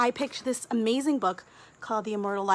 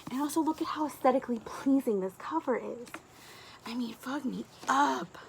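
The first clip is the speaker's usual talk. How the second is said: whisper; 3 semitones higher than usual. Real, not acted. Showing off the book cover.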